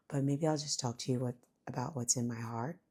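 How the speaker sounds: quick real whisper, voice failing; whispering so the sleeping son stays asleep. Real, not acted. Sick mother talking to viewers with a fading voice, whispering. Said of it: "I'm losing my voice a little bit".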